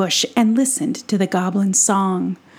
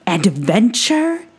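high, excitable little gnome voice; excitable Brisbane; 4 semitones higher than usual. Acted. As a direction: squeak it out like an eager tiny adventurer. Brisbane explains his freedom.

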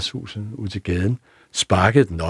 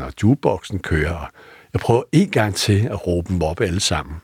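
ordinary talk, nothing marked

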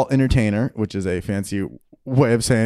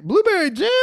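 high, incredulous mock question; mock disbelief. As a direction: act shocked at the menu. Mock diner reading menu.

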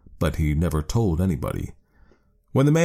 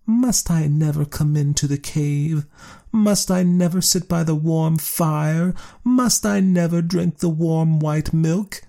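higher, slow, pleading; slow, higher false pleading; mock sorry cat; 10 semitones higher than usual. A narrator's reading. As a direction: whine it like a fake-sad cat. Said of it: "Then Cat pretended to be sorry and said".

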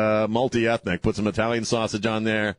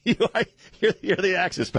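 laughing; 10 semitones higher than usual. Real, not acted. Host laughing at caller's joke.

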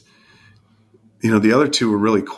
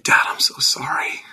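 whispered apology. Retold or imitated. The son in the dream apologizing to his dad, a whisper. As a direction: whisper it like a sorry teenager.

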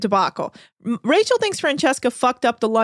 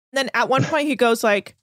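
ordinary delivery

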